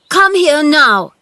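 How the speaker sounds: high, loud command; shouting. A narrator's reading. Boy calling Dickon to help him, shouting. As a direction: call him over urgently. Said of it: "he shouted to Dickon".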